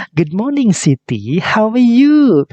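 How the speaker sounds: high, stretched-out cheerful greeting; a mother greets her daughter Siti at breakfast, high and bright. Acted. Teacher voices mother greeting Siti.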